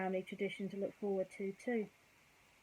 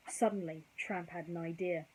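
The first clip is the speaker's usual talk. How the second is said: ordinary delivery, nothing marked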